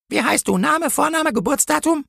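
high-pitched official questioning; interrogating policewoman. A narrator's reading. Policewoman questions the angel for a record, high-pitched and officious. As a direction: demand details in a high policewoman voice.

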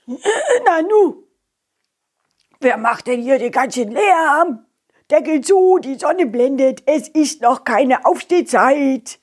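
sleepy, grumpy mole voice; grouchy creature just woken. Acted.

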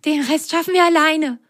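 high confident sock voice; small sock voice. Acted. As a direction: assure the dog cheerfully. Sock speaking. (hohe Sockenstimme)

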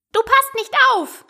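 high-pitched scolding mouse; stern mouse. A narrator's reading. Mouse scolding inattentive Alice, sternly. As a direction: reprimand sharply in a tiny high voice. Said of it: "sagte die Maus strenge".

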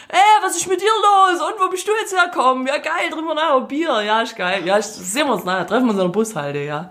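schwäbische Kollegen, erst höher und laut, dann locker; Schwäbisch. Retold or imitated. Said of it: "völlig abgedriftet". (schwäbischer Kumpel, anfangs hoch und laut)

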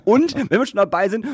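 normal talking voice

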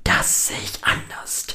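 cool whispered contradiction; taunting inner voice. A narrator's reading. Inner voice answers. (kühl geflüsterter Widerspruch)

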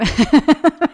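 short, loud embarrassed laugh; a sheepish burst of laughter. Real, not acted. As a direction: laugh at yourself, caught out. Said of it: "ich vergesse immer, dass das hier andere Leute hören".

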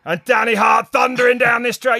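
high, loud, hyped commentator call; over-the-top shouting commentator; shouted race call. Retold or imitated. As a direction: belt it like a live downhill race call.

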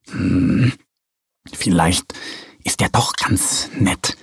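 low whispered inner thought; thinking aloud. A narrator's reading. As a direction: mull it over to herself. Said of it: "denkt die Katze".

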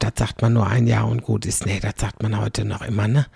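ordinary delivery

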